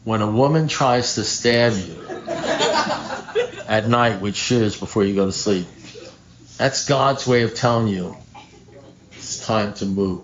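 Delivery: slow, deliberate delivery with long pauses; slow, spelled out. Shown as a demonstration. Explaining to alcoholics who need it slow, measured and deliberate. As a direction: spell it out slowly, phrase by phrase. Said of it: "you gotta talk slowly to alcoholics".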